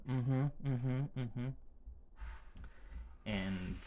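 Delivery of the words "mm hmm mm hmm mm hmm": higher hum from the son; imitating her son. Mother imitating her son's hesitant hum, higher. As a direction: mimic the boy's unsure hum.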